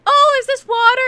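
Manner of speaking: high, loud mock-sweet guard voice; imitating the guard who found the water bottle; security guard. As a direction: play the fake-polite guard finding the water. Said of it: "and she's like".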